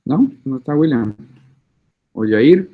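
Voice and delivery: usual speaking voice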